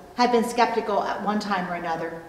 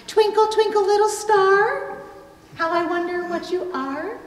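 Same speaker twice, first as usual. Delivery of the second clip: mocking nursery rhyme; 10 semitones higher, 4 dB louder than usual.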